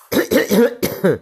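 loud throat clearing. Real, not acted.

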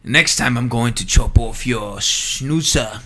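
mock London accent. Imitating a London movie tough guy, a light London accent. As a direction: growl a cockney threat, half-joking. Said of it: "London style".

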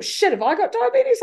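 high, swooping alarmed question; mock alarm. Seeing the diabetes sign on the plane, alarmed. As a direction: play up a sudden worry.

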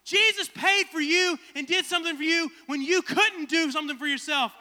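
high, loud preaching voice. Acted. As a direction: preach it to the church. Preaches to the church.

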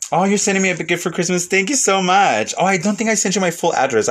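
light up with joyful surprise at the gift; delighted thanks. Real, not acted. Reacting to a viewer's gift.

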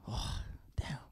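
under-breath whisper. Real, not acted. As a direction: whisper it to yourself. Thinking hard about choice.

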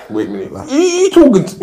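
a husband affronted by her tone; mock outrage from the husband; indignant disbelief. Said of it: "you're like".

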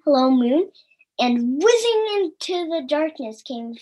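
gentle storybook reading voice; story narration. A narrator's reading. Reading the creation story to children, gentle storytelling. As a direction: read it like a bedtime Bible story.

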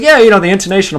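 imitating band director. Retelling his high school band director, mock lecturing tone. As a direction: ham up the know-it-all band director. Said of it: "And he's like".

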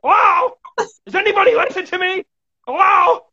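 high-pitched exasperated PR guy impression; mock indignant yelling. PR guy interrupts the interview, mock indignant yelling. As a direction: imitate a fed-up handler butting in, voice way up high. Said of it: "came on and started yelling and said".

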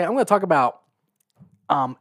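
ordinary delivery

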